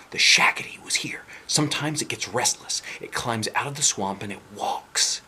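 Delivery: eerie whisper, slowing at the end; spooky whisper. Acted. Boy whispering monster story to newcomers. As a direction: whisper it like a campfire tale.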